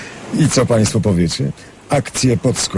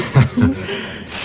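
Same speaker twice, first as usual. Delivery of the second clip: breathy embarrassed giggle; shy laugh; 12 semitones higher, 5 dB quieter than usual. Acted. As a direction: laugh it off bashfully. Laughs at question about love.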